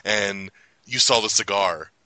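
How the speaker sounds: normal talking voice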